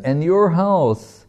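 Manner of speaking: playful needling, lightly acted; teasing. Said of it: "teasing him".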